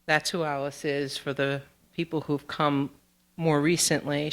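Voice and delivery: ordinary delivery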